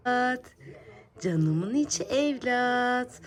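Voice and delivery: high, loud and slow; adoring coo. Real, not acted. Mother cooing adoringly at her baby. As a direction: pour love into each word.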